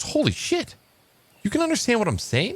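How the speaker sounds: high-pitched astonished disbelief; acted amazement in a high voice; amazed. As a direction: gasp it out, stunned, way up high. Guy amazed that parrot talks.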